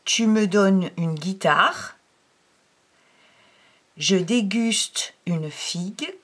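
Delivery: teacher reading practice sentences aloud; reading aloud for pupils. Shown as a demonstration. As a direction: clear dictation-style reading. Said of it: "je vais vous lire les phrases".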